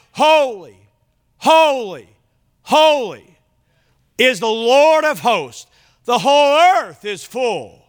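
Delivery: loud, high proclaiming cry of the seraphim. Acted. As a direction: lift it high like angels calling across the throne. Angels crying out in scripture.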